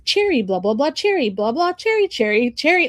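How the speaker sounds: sing-song swoops up on each repeated word; mock repetitive recitation. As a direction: parody a word popping up endlessly. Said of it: "all I could hear every three words was cherry".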